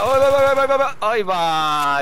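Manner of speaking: loud, high burst of excitement; rapid-fire high shouting in amazement; excited exclamation. Real, not acted. Gamer reacting to his own gameplay clip, excited shout.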